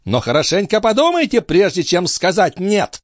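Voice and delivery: very high feminine ultimatum; demanding countess. A narrator's reading. Countess warning the police chief, high and demanding. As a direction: press him hard in a lady's high voice.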